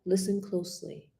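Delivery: soft, far-off whisper. Shown as a demonstration. Coach demos a whisper to students, soft whisper. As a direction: breathe it out softly as a secret. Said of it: "in a whisper".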